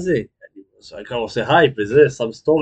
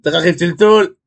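high, loud hammed-up alarm; mock dismay; 10 semitones higher, 10 dB louder than usual. Streamer whose ringtone went off live, in mock high alarm. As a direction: play up the panic, much higher and louder.

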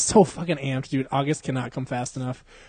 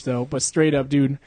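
ordinary talk, nothing marked

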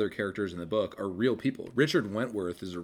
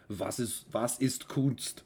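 German; 3 semitones higher, slower than usual. Retold or imitated. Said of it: "the phrase sort of like".

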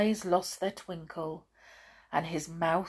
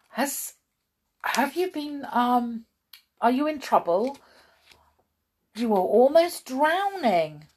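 higher, louder worried questioning; alarmed concern; 7 semitones higher, 6 dB louder than usual. A narrator's reading. Mister Pinkwhistle questioning the rescued dog, concerned. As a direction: ask urgently, voice pitched up.

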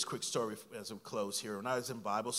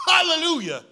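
much higher, louder with a laugh in it; ecstatic hallelujah; 14 dB louder than usual. Retold or imitated. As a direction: burst out in joyful praise. Joyful reaction retold.

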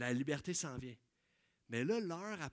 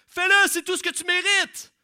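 much higher and louder harsh yell; full shout; 12 semitones higher, 16 dB louder than usual. Acted. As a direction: bellow it with all your strength. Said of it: "Paul cria de toutes ses forces".

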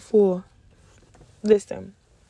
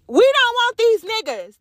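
high emphatic declaration; 9 semitones higher, 3 dB louder than usual. Real, not acted.

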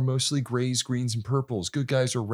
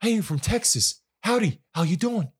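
a light mock Texan accent, higher; 8 semitones higher than usual. Mocking Wahlberg's Texan character, light mock Texan accent. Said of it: "he talks like this".

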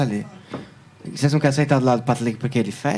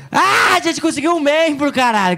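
excited cry; 11 semitones higher than usual. Real, not acted. Streamer celebrates a new channel member, excited cry. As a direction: shout it out in real delight.

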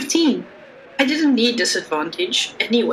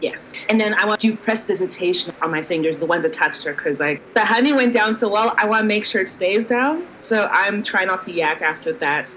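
usual speaking voice

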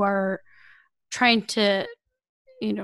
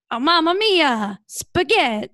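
hammed-up Italian accent, higher and louder; mock Italian; 7 semitones higher, 5 dB louder than usual.

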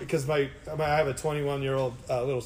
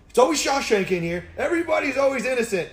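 louder, higher exaggerated quote of himself; mock proclamation; 8 semitones higher, 6 dB louder than usual. Shop boss greets a damaged car, mock proclamation. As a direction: announce it like a running joke. His stock line to shop people.

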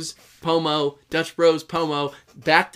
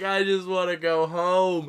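slow, lightly tearful whine; a hint of retold crying; mock crying; 2 semitones higher, slower than usual. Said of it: "I was crying and I was like".

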